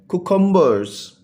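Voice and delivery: कुकुम्बर्स sounded out as cu-cum-bers; deliberate three-beat कुकुम्बर्स; syllable by syllable. Shown as a demonstration. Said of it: "three syllable word है".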